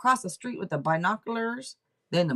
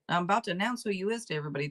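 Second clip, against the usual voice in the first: normal talking voice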